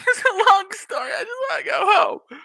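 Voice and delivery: high whimpering whine; mock whiny crying. Beaten kid pleading to go home, whiny. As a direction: whine it through fake tears.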